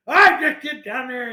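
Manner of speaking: hammed-up military bellow; hardcore doctor. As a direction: imitate an old general shouting a command. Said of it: "he was hardcore".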